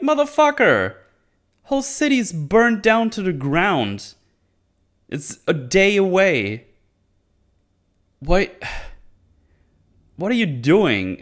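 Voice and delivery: high-pitched, incredulous mock rant; exasperated outburst. Exasperated retort to careless innkeeper.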